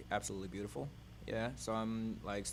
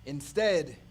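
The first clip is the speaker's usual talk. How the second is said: poem reading; 4 dB louder, much slower than usual. Acted. Poet opening an imagery poem for the audience, calm reading. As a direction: paint the images slowly.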